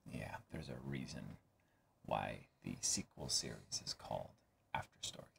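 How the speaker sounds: hushed, breathy warning; ominous whisper. Acted. Hinting to the audience what the sequel holds, in a low whisper. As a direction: whisper it like a dark secret.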